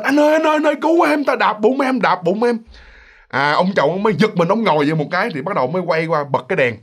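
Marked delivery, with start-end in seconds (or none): 0.0-2.6 high, urgent acted plea to a husband; retold cry for help. Pregnant wife calls her husband after being stepped on, acted cry for help. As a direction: voice a panicked wife calling out, pitched high without a full scream. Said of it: "Bắt đầu mới la lên".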